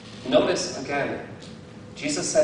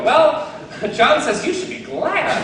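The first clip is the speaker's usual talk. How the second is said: high, loud, laughing tattle; mock wife reporting; 12 semitones higher, 8 dB louder than usual. Wife telling Pastor Wayne about the sermon, high loud mock tattle. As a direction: tell on the preacher in a sing-song. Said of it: "Tell Pastor Wayne, how did the sermon go?".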